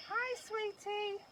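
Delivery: high-pitched, drawn-out sing-song; sing-song call. Shown as a demonstration. Owner triggering the talking baby doll, high sing-song call. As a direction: coo it high and slow to a baby.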